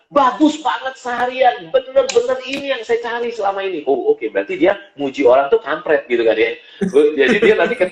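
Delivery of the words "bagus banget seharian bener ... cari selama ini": hearty praise. Retold or imitated. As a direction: imitate a rough man praising earnestly. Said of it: "Terus dia bilang".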